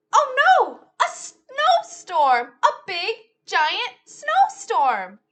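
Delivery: mock-alarmed storytime cry. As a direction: exclaim as if spotting danger. Alarm at snowstorm.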